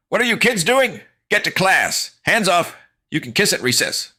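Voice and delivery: high, loud barking teacher voice; gym teacher yelling. Acted. Gym teacher shouting at the kids to get to class. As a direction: scold the kids off.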